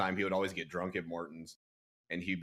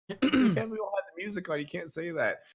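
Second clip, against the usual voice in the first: urgent scolding; 11 semitones higher, 4 dB louder than usual. Retold or imitated. Flustered staffer rushing back to scold him.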